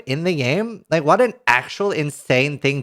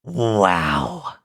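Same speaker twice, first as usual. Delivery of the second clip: awed wow; 10 semitones lower than usual. Real, not acted. Reacting to patch note.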